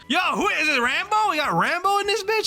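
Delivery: very high-pitched, excited exclamation; hyped disbelief. Real, not acted. Streamer reacts to a huge kill count, hyped and incredulous. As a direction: voice leaps right up in amazement.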